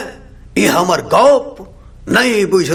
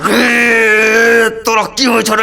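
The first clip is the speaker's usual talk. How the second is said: indignant outburst; 3 semitones higher, slower than usual. Acted. Angry outburst at being laughed at.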